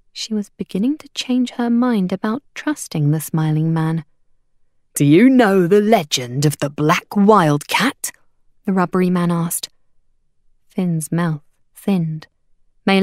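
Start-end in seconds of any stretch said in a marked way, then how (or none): 4.9-8.1 slow, drawn-out storytelling question; hawker's sales pitch. A narrator's reading. Hawker luring travellers with a legend, slow and showy. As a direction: dangle the legend like a showman.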